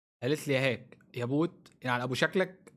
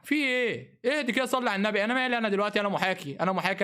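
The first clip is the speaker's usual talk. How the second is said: indignant protest; 6 semitones higher than usual. Real, not acted. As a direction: defend yourself, outraged. يحتج بعد أن شُتم. (احتجاج غاضب بصوت عالٍ)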